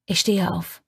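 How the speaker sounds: usual speaking voice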